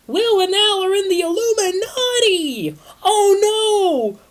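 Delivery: high, loud frantic squeal; panicked shriek. Acted. Triss shrieks the news to Christina in panic.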